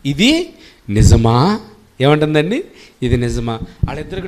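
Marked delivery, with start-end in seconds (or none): 0.1-1.6 slow, sly tempter's question; tempting serpent. Acted. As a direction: drawl it out like the serpent luring her.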